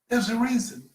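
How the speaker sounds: normal talking voice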